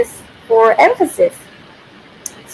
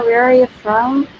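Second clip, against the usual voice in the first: usual speaking voice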